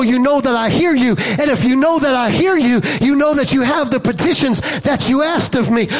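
rising, ringing preacher's call, building to a shout; full-force preaching. Acted. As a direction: preach it hard to the whole church.

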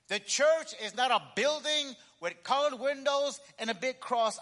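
loud, slow, punched-out declaration; emphatic preaching. Acted. Preacher defining the church to his congregation, emphatic and forceful. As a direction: proclaim it phrase by phrase, with weight.

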